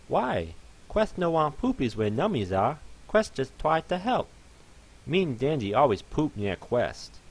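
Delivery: put-on high, hurt baby-talk voice; a small pony insisting it helped; pleading fluffy pony.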